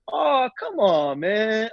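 high, slow, laid-back mock protest; lightly voiced chill boyfriend; easygoing guy. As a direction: shrug it off like a mellow dude. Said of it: "He's like".

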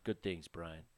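low, soft sultry murmur; sultry perfume ad. Acted. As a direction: purr it like a perfume ad. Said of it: "That was his sultry voice".

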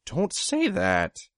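high, loud, drawn-out horror; horrified gasp. A narrator's reading. Narrator to wounded Gru after talk of poison, horrified gasp. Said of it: "I gasped, horrified".

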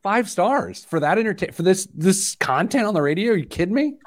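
high-pitched, incredulous enthusiasm; mock indignant. Real, not acted. Radio host defending a five-star rating, mock indignant. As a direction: protest with rising disbelief, breaking into a laugh at the end.

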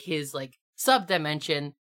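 ordinary talk

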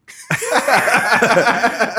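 a high, rising laugh; real laugh. Real, not acted. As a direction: laugh at the kind put-down. Laughing after telling her reply.

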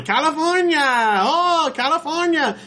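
very high, delighted Olive Oyl cry; Olive Oyl squeal. Retold or imitated. Olive Oyl greeting the visitors from California, high and thrilled. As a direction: squeal the name like a thrilled cartoon girl. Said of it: "she's doing a Shelley Duvall's Olive Oyl talk".

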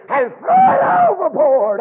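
high, loud shouted punch; preaching shout. Real, not acted. Preacher shouting over the congregation. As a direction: fling the line out to the church.

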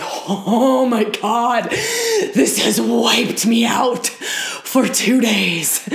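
slow, loud mock-distraught wail; hammed-up overwhelm. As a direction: exaggerate being utterly wiped out, dragging the words. Imagines being emotionally wiped out.

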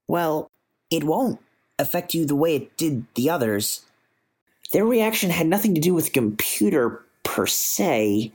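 a keen physicist proudly explaining; eager scientist voice. Acted.

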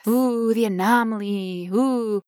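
drawn-out ghostly oohs; mock spooky. Patient mocking being the anomaly, mock spooky. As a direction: wail it like a pretend ghost.

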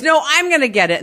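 high, loud insistence; mother insisting. Retold or imitated. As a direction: cut the kids off, claim the phone. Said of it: "she goes".

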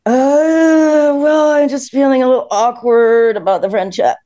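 confessing awkwardness to a friend, high and nervous; nervous confession. Retold or imitated. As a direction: force the awkward words out, voice up. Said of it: "and then I said".

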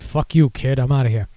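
ordinary talk

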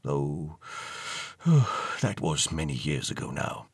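low, soft, with a heavy sigh; mournful old gentleman, hushed and deep; wistful old ghost. Acted. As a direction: sigh over days long gone. Ghost tearful over his past.

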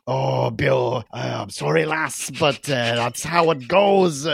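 mock-regretful Scottish Doctor; hammed-up Scottish accent. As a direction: apologise in a put-on Scottish brogue, slowing at the end. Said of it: "My Scottish accent is fucking spot on".

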